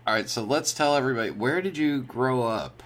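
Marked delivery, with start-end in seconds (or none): none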